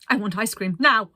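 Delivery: put-on whiny toddler demand, loud on now; insistent demand. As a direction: stamp the demand like a cross child. Toilet Situation demands ice cream.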